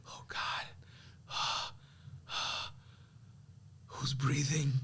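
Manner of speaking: hushed, frightened whisper; scared whisper. Retold or imitated. Alone in the creepy house at night, a frightened whisper. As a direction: breathe it out in fear.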